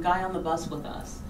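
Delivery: normal talking voice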